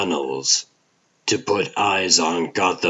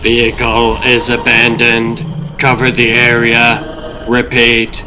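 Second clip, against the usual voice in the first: loud, higher, level radio call; radio dispatch; 5 semitones higher, 7 dB louder than usual. Acted. Pursuit team calling in air units, loud radio call. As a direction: call it in urgently over the radio.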